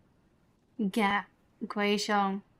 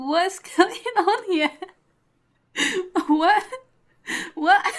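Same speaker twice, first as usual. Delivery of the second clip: slow, higher, laughing disbelief; 9 semitones higher, 7 dB louder, slower than usual. Real, not acted. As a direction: crack up while asking what's happening. Laughing at Paimon's imitation.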